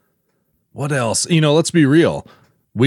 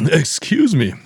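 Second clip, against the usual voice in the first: choking a little on coffee; coffee down wrong pipe; 8 semitones higher, slower than usual. Real, not acted. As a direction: clear throat after a swallow gone wrong. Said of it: "Coffee went down the wrong pipe".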